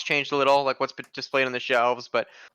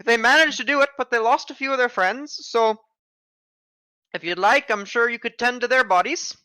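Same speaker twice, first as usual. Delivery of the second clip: put-on high, louder old woman voice; a chatty old shopkeeper granny; old lady voice; 7 semitones higher, 4 dB louder than usual. Shopkeeper lady gives a tip.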